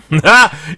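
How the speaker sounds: loud high laugh; laughing at his own question, loud and high. Real, not acted.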